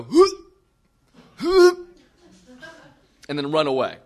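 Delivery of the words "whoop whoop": high, loud "whoop" imitation; sudden high whooping heave; imitated retching whoop. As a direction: mimic her noise in two loud bursts. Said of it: "I hear her go".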